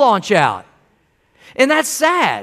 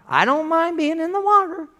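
higher-pitched voice of a complacent believer; complacent churchgoer; 6 semitones higher, 4 dB quieter than usual. Acted. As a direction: put on a higher, casual voice for the half-hearted. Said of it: "we get the mindset".

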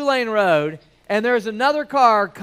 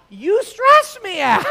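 higher, louder, slow mock-exasperated retort; mock exasperation; 10 semitones higher, 6 dB louder, slower than usual. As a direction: tease your son that he's the stressful one. Retorting to teenage son.

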